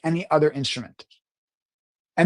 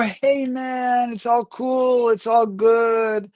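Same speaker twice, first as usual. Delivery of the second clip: high, drawn-out easygoing drawl; hey-man cool, sing-song and high; laid-back cool; 6 semitones higher, much slower than usual. Shown as a demonstration. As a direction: play it super chilled, stretching each phrase. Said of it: "The same words can be spoken differently".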